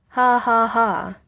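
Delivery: cold mock laugh. A narrator's reading. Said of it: "said Steve coldly".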